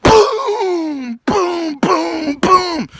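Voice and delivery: high, loud booming crash sounds; impact noises. Retold or imitated. Narrator voicing the car hitting the deer, booming. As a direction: shout each thud of the crash.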